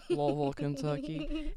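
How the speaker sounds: slow southern drawl on Louisville, Kentucky; southern twang. Shown as a demonstration. Podcaster showing off her hometown, southern twang. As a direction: lean into the Kentucky twang, unhurried. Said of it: "southern twang is, like, a little bit present".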